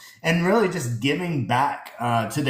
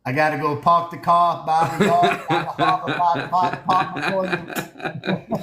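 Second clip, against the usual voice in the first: mock Boston accent; 4 semitones higher than usual. Teasing the Boston partner about his car, in a mock Boston accent. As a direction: stretch the words like a Boston local, chuckling. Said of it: "always trying to make fun of us with our weird accents".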